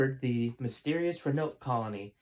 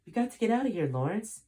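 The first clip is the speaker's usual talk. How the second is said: high, urgent assassin voice; the right voice, pitched way up; high-pitched loyal assassin; 9 semitones higher than usual. Acted. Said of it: "wrong voice".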